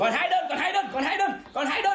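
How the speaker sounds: high-pitched sales hype; excited. Real, not acted. Livestream sale countdown hype.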